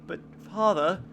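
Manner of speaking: mock British child; a light, put-on British accent. Imagined child addressing father, mock British accent. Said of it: "my children are gonna be British".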